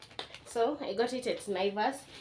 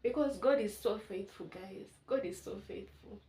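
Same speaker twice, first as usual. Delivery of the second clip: getting emotional; 6 dB quieter than usual. Real, not acted. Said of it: "I'm getting emotional".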